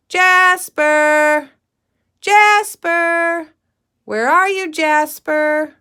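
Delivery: put-on girl searching for her missing dog, calling out. A narrator's reading. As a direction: call loudly for the lost dog. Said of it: "called Katie".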